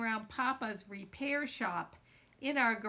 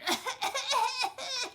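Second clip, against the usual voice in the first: put-on high-pitched child's laugh; high giggle; 12 semitones higher, 4 dB louder than usual. Little brother laughing as he flees the kitchen. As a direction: cackle like a kid who just got away. Joji runs off choking.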